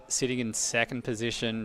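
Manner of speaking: ordinary talk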